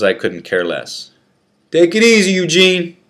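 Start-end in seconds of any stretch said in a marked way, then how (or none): 1.7-3.0 higher, louder call; friend calling out. Acted. Friend calls out to the escorted prisoner, loud encouragement. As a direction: shout encouragement from the cell. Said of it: "he replied".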